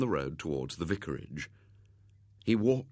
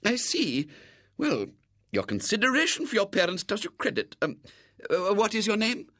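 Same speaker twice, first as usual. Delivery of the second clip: high-pitched, gracious lady; approving lady; 11 semitones higher, 3 dB louder than usual. A narrator's reading. Lady commends the boy for his consideration, approving. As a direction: praise him in a high voice.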